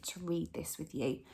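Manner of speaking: excited whisper. Real, not acted. Teacher whispering her excitement to the children. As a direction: whisper it like a secret to the class.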